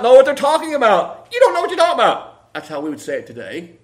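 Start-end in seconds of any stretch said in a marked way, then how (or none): 1.3-2.5 quick, higher, brisk retort; fast modern paraphrase. Shown as a demonstration. Preacher giving today's wording, quickly and sharply. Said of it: "and not quite that fast".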